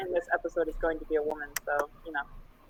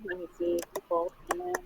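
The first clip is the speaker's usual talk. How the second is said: barely audible; 11 semitones higher, 8 dB quieter than usual. Real, not acted. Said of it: "No, it's really hard to hear you".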